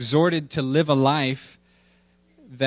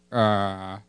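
cartoon dad; 6 semitones lower than usual. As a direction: answer as a subdued cartoon daddy. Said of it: "Daddy, do you believe".

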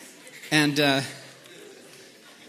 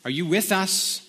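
scripture reading; 3 semitones higher than usual. A narrator's reading. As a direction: read the passage aloud with measured weight and pauses. Reads scripture aloud.

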